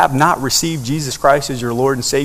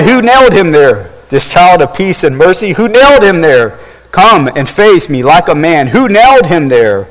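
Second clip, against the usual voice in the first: higher, louder accusing cry; crying out in anger; 4 semitones higher, 7 dB louder than usual. Acted. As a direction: hurl the question at the crowd. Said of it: "And I cried".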